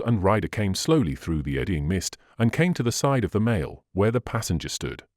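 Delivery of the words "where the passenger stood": ordinary delivery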